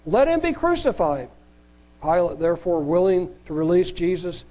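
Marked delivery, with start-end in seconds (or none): 0.1-1.3 much higher, raised crowd cry. Acted. Crowd crying out more at Pilate, high raised cry. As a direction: cry it out higher than before the crowd rose. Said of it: "they cried out more".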